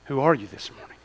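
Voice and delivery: ordinary talk, nothing marked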